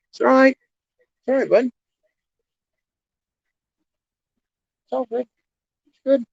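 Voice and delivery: high, gentle calming coo; soothing scared goats. Real, not acted. I got all the goats around my feet.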